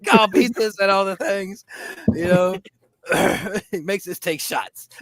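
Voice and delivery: high giggly voice; talking through the giggles; laughing. Real, not acted. Still laughing after queef joke.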